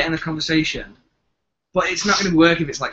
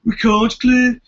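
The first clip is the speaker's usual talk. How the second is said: high, loud Brummie impression; mock a Brummie, stretched out and raised; Birmingham accent; 9 semitones higher, 7 dB louder, much slower than usual. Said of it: "he would really have the Birmingham accent".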